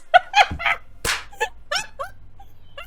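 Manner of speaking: very high wheezing laugh; squealing laugh. Real, not acted. Streamer cracking up at her own joke, high squeal. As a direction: crack up helplessly.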